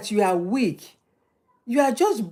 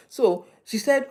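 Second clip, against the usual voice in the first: normal talking voice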